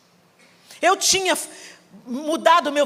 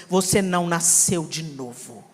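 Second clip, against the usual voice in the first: lower, slow, solemn rebuke; mãe repreendendo; 8 semitones lower, 3 dB quieter, slower than usual. Retold or imitated. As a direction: deliver it like a quiet verdict. Mãe repreende com autoridade. (mãe grave e lenta, num tom de sentença)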